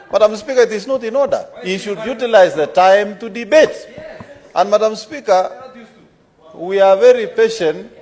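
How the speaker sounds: high, loud, half-laughing protest; heated objection. Real, not acted. Point of order, heated objection.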